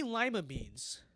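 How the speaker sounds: "lima" said LYE-muh in disbelief; high, baffled LYE-muh beans; disputed pronunciation. Real, not acted. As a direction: say "lima" with a long eye sound. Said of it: "I thought it was Lima".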